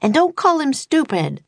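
high, indignant shout; yell. A narrator's reading. Annie yelling at Baylor, high and indignant. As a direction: cry out in protest. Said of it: "yelled Annie".

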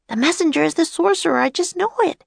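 put-on high, quick excited girl voice; excited certainty. A narrator's reading. Girl insisting to her companion about the messenger, high excitement. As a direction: blurt it out, pitched well up.

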